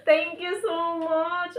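slow, high thanks through a laugh. Real, not acted. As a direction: laugh out a grateful thank you. Thanking the employers. (natatawang pasasalamat, mataas at mabagal)

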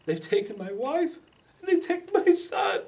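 a husband crying out over his lost family; overacted grief. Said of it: "Sorry for the overacting".

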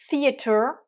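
theater with a crisp British t; British accent. Shown as a demonstration. Teacher demonstrating British pronunciation. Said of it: "theater avec l'accent, euh, britannique".

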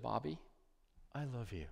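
very soft, hushed words of love; a mother tucking her son in, murmuring softly; tender mother's whisper. Retold or imitated. Mother at bedtime tells her son she loves him, hushed and tender. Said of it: "and she said".

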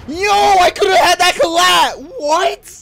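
excited shout. Real, not acted. Gamer reacts to missed kill.

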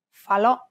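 falò with the last syllable stressed; stress on final vowel. Shown as a demonstration. Host shows grave accent examples, final ò stressed in falò. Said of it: "end of a word can have a grave accent".

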